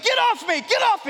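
high, loud panicked yell; yelling at the dog. Retold or imitated. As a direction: shriek it at the jumping dog. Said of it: "I start yelling at the dog".

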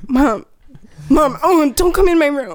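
put-on high panicky kid pleading with mom; hammed-up teen caught out; embarrassed kid.

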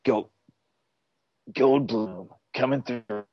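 slow, put-on Goldblum voice; drawn out like a radio call; walkie-talkie voice. Shown as a demonstration. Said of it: "These are my walkie-talkie voices".

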